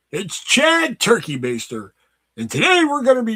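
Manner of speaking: polish it like a showy YouTuber; hammed-up character intro; Chad Turkey Baster voice. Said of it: "I just put that polish on there".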